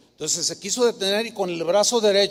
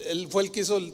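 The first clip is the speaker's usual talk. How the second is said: ordinary delivery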